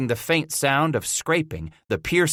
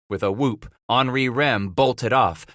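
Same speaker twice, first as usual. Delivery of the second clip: plain reading voice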